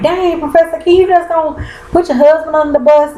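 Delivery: high, incredulous call-out to the TV; dang, are you serious; shocked disbelief. Retold or imitated. Viewer reacting to Professor K on TV, high shocked disbelief. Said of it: "now I'm like".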